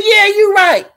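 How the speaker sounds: high, loud wailed concession; loud agreement. Retold or imitated. Her loud retort to doctor.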